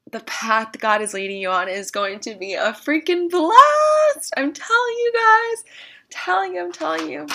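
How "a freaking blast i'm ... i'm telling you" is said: bursting with excitement, then tailing down; excited. Real, not acted. Speaker promising viewers God's path is fun, excited.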